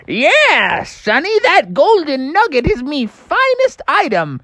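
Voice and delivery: put-on high, creaky old miner; old prospector. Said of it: "the old Sourdough Sam said".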